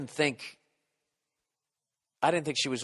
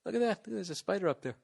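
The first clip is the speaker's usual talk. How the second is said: distracted musing; 5 semitones higher, 7 dB quieter than usual. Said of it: "and I went".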